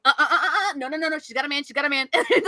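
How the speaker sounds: ordinary talk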